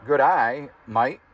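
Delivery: Australian accent by sound-alike words, "mate" rhyming with "bite"; mock Australian accent. Shown as a demonstration. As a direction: say "Good eye, mate" with mate as "might". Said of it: "how to pronounce things in Australian accent".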